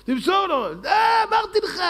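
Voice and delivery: exasperated yell, shooting up high; mock fan shouting. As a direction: heckle the player like a fan at the screen. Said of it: "צועק לו".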